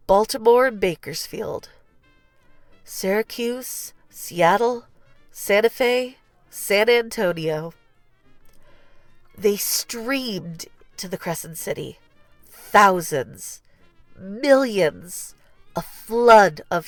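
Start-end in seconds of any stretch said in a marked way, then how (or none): none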